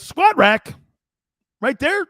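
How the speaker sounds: high, loud quoted questions; punchy high-pitched imitation of a teammate; loud questioning reply. Jerome's acted reply to Herrick.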